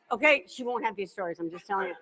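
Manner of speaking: ordinary talk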